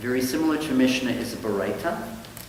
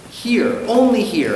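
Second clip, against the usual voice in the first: mock announcer; 10 semitones higher, 6 dB louder than usual. Announcer-style show intro.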